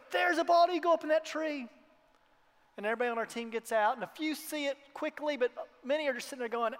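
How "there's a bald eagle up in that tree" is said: excited call. Retold or imitated. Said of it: "he pulls over and he goes".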